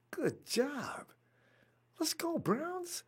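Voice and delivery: soft unvoiced whisper; hushed good job; whispered praise. Real, not acted. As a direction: breathe the praise under your breath.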